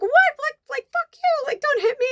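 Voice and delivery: very high acted outrage; indignant protest. Shoved friend protests at being offered up, high indignant outburst. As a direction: squawk back in mock outrage, pitch right up.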